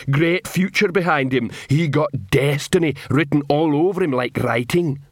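simple troll boasting happily; a troll showing off his helmet; proud troll voice. Acted.

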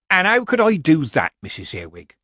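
high innocent question, dropping away at the name; mock meek. Granny answers Missus Earwig's accusation, mock meek. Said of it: "said Granny meekly".

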